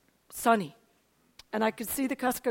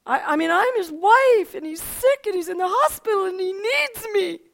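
higher, louder pleading with God, acting out the upset; retold tearful plea; 6 semitones higher, 7 dB louder than usual. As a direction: protest as if close to tears, voice pitched up. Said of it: "That's, I was crying".

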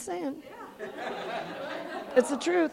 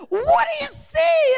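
very high, slow screamed question; 3 dB louder, slower than usual. Retold or imitated. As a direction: scream it, stretching the words. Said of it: "he just screamed it. He said".

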